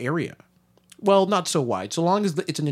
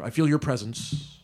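ordinary delivery

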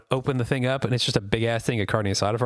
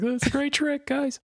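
much higher, airy mock voice; floating in space; 12 semitones higher, slower than usual. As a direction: squeak it out like a tiny voice lost in space.

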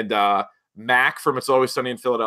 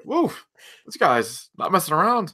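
mock impressed woof; 7 semitones higher than usual.

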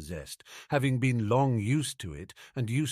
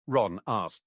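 usual speaking voice

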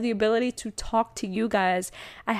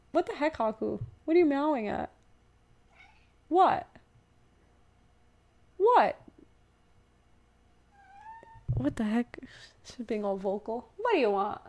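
talking to the cat; 4 semitones higher, 4 dB quieter than usual. Real, not acted.

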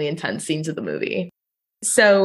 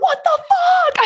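high, slow, shouted disbelief; shocked shout; slower than usual. Real, not acted. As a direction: yell it out in stunned horror. Stunned memory of scene.

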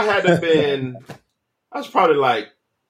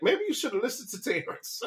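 soft, higher-pitched wife voice; pastor's wife; 7 semitones higher, 11 dB quieter than usual. Retold or imitated. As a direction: quietly chide him in a small high voice. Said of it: "his wife was like".

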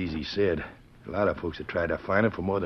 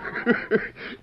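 breathy mocking laugh. Laughing at the cat.

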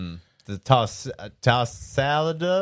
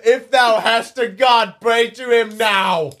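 slow, loud, higher archaic threat through a laugh; mock villain; 9 semitones higher, 6 dB louder, slower than usual. Villain ordering his victim to pray, loud and hammed-up. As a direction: ham up the villain's doom warning.

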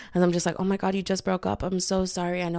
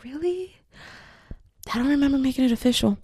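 mock surprise; 8 semitones higher than usual. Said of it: "and I'm like".

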